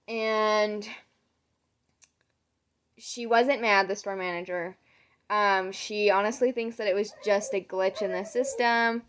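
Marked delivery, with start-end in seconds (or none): none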